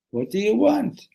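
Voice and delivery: high-pitched complaint; exasperated retort. Acted. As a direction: demand it in exasperation. Exasperated at second interruption.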